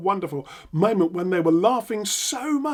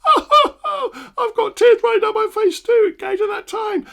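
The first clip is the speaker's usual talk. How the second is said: very high squeal, bursting into loud laughter; laughing in actor's mode; 7 dB louder than usual. Shown as a demonstration. Trainer laughing with the client at the memory, acted hysterics. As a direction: laugh till you cry while urging them on. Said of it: "throw yourself into actor's mode".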